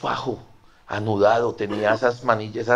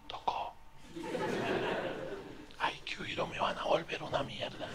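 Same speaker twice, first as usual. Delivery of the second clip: hushed whisper; 7 semitones higher, 10 dB quieter than usual. Retold or imitated. Deciding to flee, whispered.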